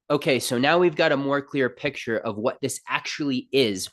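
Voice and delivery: ordinary talk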